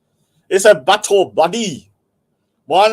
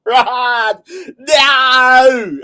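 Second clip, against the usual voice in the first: hammed-up high, loud wail; mock traumatized wailing; 7 semitones higher, 7 dB louder, much slower than usual. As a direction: imitate a friend howling down the phone. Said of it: "he's too traumatized by the news".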